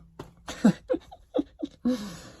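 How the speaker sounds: real high-pitched burst of laughter; laughing. Real, not acted. Vlogger cracking up at his own Texas accent, high laughter. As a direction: crack up at your own silliness.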